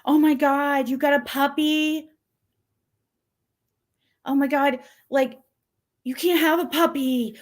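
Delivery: a hint of a frantic friend; mocking her panicked friend. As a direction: imitate a friend scolding about the puppy. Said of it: "she's like".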